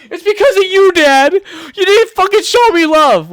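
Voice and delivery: high, loud, whiny tantrum scream; screaming at dad. Acted. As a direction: scream it like a hurt kid blaming his father. Whiny tantrum blaming his dad.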